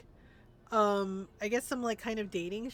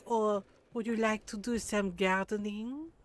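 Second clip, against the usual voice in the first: teasing put-on French accent; hammed-up French accent, offering an invitation. French mom inviting Julius to garden, teasing French accent. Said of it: "Is it because she's French?".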